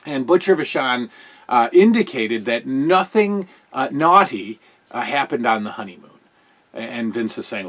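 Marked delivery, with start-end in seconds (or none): none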